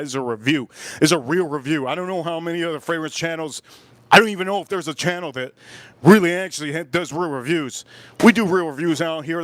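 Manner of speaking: usual speaking voice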